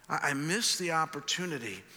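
ordinary talk

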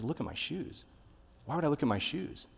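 half-voiced, baffled repeat of the sponsor; puzzled retort.

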